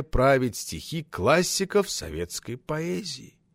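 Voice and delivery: put-on slow, soft, menacing old official; quietly sneering senior boss; stern boss. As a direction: drag out the reprimand with icy courtesy. Stern boss interrogates young editor.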